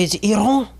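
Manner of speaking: Iran as a high "ee-RON", final n barely sounded; over-correct pronunciation. Retold or imitated. Comic mimicking someone crazy about pronouncing, over-correct pronunciation. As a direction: correct them fussily, high-pitched "Iran" with a long open o. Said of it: "goes crazy about pronouncing".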